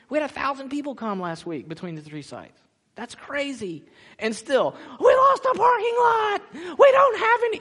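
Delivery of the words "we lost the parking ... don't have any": much higher, loud alarmed cry; panicked shout. Retold or imitated. Pastor mocking the church panicking over parking, loud cry. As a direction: shout it like the sky is falling.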